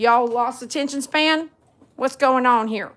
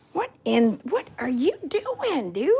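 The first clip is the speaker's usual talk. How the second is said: ordinary talk, nothing marked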